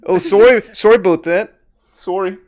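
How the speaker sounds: put-on apologetic Canadian accent; overly sorry Canadian. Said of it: "maybe I'll be Canadian".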